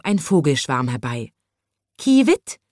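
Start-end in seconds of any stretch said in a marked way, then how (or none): 2.0-2.6 high chirpy bird cry; bird call. A narrator's reading. Birds calling to the chimney sweep, high and chirpy. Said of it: "riefen die Vögel".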